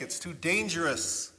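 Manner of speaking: ordinary talk, nothing marked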